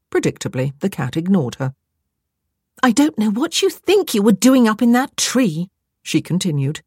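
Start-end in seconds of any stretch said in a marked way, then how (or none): 2.8-5.7 exasperated, higher-pitched telling-off; scolding. A narrator's reading. Stranded woman to the cat up a tree, scolding.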